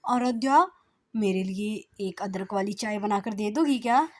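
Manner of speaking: loud call to his wife; calling across the house; husband calling out. Acted. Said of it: "पति आवाज लगाते हुए".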